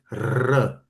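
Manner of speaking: R as a rolled Czech trill. Shown as a demonstration. Learner explains his language's sound, trilled Czech R. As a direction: roll the R on the tongue tip. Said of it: "completely different R".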